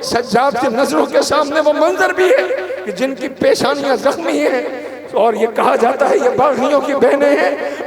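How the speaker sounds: high, loud, sobbing account of the captives; tearful preaching. Acted. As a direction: preach the scene of the captive women through tears. (रोते हुए ऊँची आवाज़ में मसाइब)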